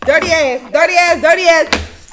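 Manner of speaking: loud repeated insult; yelling insults at him. Real, not acted. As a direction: shout it again and again. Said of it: "I'm sorry for all that yelling".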